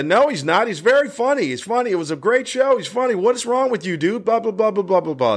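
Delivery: high-pitched overlapping protests, run together; young guys chiming in. Retold or imitated. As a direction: imitate a group eagerly defending him, trailing into blahs. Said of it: "They start chiming in".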